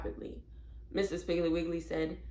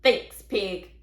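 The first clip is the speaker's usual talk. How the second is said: rude parrot; 9 dB louder than usual. A narrator's reading. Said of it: "Penelope snatched it and said".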